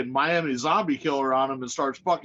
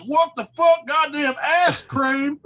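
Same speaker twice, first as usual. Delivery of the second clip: much higher and louder, yelling out; blinded man told he wears a Packers hat, shrieking; outraged shriek; 10 semitones higher, 4 dB louder, slower than usual. Retold or imitated. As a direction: explode in shocked fury. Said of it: "Michael says".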